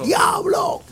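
high shouted hellfire word; mock hellfire shout. Mocking harsh preachers, high shouted cry. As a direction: bellow it like a fire preacher.

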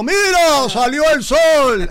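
hammed-up excited call of the sunrise; mock announcing shout. Preacher mocks a God who shouts his works, high mock shout. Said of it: "y no está gritando".

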